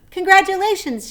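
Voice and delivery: loud, high excited shout. A narrator's reading. Wilbur shouting congratulations to the goose, loud. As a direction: shout it joyfully. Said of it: "shouted Wilbur".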